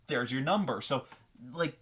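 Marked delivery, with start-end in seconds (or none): none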